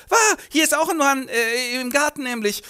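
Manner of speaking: high, loud startled squeal. Acted. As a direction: yelp it out, caught off guard. Gabi startled by a knight.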